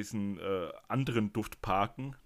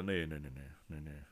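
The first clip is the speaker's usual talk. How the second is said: disgusted; 6 semitones lower, 7 dB quieter than usual. Real, not acted. Disgusted at the space scent.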